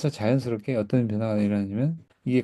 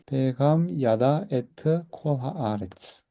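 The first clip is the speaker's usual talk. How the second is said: slow, careful Hebrew recitation; teacher modelling the sentence slowly; slow model reading; 4 dB quieter, much slower than usual. Shown as a demonstration. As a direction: read it out slowly, word by word. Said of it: "그래서 이제 베캄 야다".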